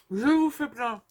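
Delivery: talking with a full mouth; words through a mouthful of chocolate; chewing while talking. Real, not acted. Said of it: "C'est pas bien, hein, de parler la bouche pleine". (la bouche pleine en parlant)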